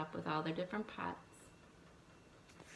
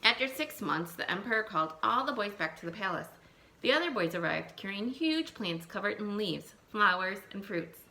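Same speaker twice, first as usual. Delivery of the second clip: put-on lively read-aloud for young children; storybook reading; 3 semitones higher, 4 dB louder than usual. Teacher reading a folk tale to her class, lively storytelling.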